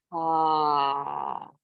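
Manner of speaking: long, open-mouthed exhaling sigh; dramatic sigh. Shown as a demonstration. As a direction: let a big breath fall out on ah. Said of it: "just big open mouth sigh".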